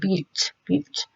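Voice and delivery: Beaut clipped off on its own, very soft; just the first beat Beaut; isolated first syllable. Shown as a demonstration. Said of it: "Where does it end?".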